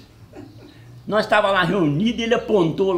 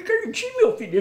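mock sweet greeting. Narrator greets Carlitinho again.

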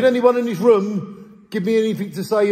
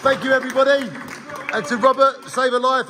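excited; 6 semitones higher than usual. Real, not acted. Leading a cheer for Dan.

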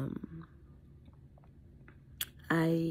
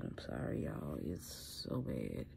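apologetic hush; 13 semitones lower, 14 dB quieter than usual. Real, not acted. Apologizing mid sneezing fit.

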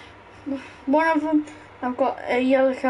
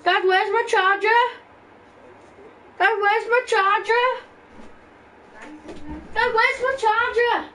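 yelling across the room; 8 semitones higher than usual. Real, not acted. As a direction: shout for dad from another room. Calling dad from another room.

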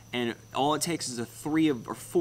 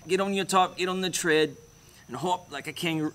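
higher-pitched put-on Aussie accent; mock Australian accent; 5 semitones higher than usual. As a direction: play a hammed-up Australian coach urging someone on. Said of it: "Don't talk like that".

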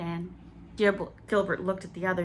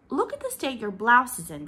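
a scolding mother's louder reproach; severe; 5 semitones higher, 6 dB louder than usual. A narrator's reading. Leslie scolding her muddy son severely. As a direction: scold the muddy child sharply. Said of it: "said Leslie rather severely".

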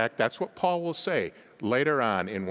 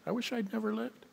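"I wish I'd never lived" repeated much higher and softer; wistful high repeat; 9 semitones higher, 6 dB quieter, faster than usual. Retold or imitated. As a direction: echo it higher, softer, as a lament. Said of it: "what he's really saying is".

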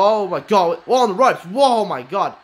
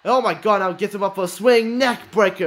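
ordinary talk, nothing marked

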